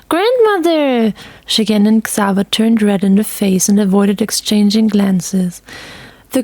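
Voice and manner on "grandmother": high, slow, drawn-out protest; little girl mortified by her grandmother; embarrassed girl. A narrator's reading. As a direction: cry out the name in mortified protest.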